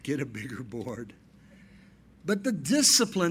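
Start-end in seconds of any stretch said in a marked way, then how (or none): 0.0-1.1 softer, savoured echo; a quiet relish of the line; repeated line. Shown as a demonstration. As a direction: say it again, low and pleased. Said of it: "that sounded good".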